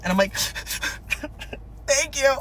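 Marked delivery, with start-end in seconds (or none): 0.4-2.4 high tearful thanks; mock sobbing. Sobbing driver takes papers back, mock crying. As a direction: squeak it out through pretend sobs. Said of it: "I'm sobbing".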